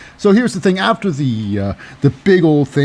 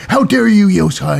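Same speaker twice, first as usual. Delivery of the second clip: high, loud indignant cry; hammed-up offended wrestler; mock outrage; 7 semitones higher, 4 dB louder than usual. Shotzi confronting Io Sky, hammed-up outraged shout. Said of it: "And she goes like".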